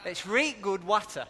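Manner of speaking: imitated broad Yorkshire, short-a water; Yorkshire accent. Preacher mimics Yorkshiremen praising water, broad Yorkshire accent. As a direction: put on a Yorkshire dialect. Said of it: "to be fair to Yorkshiremen".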